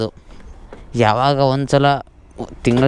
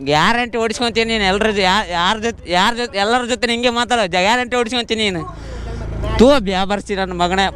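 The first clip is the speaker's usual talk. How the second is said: high-pitched, worked-up rant; angry threats; 9 semitones higher, faster than usual. Real, not acted. Rider threatens and swears at someone, angry high-pitched rant. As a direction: rant in a raised pitch, repeating yourself.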